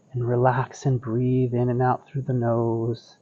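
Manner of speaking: soft, soothing guided relaxation; serene tai chi cues. Acted. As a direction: ease them into calm breathing. Guides relaxed breathing practice.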